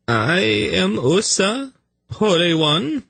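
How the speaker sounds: high, slow fawning voice with an oily bow; oily servant. A narrator's reading. Servant bowing to the hierarch, high oily fawning. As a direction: grovel up at him, all oil. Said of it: "stepping forward with an oily bow".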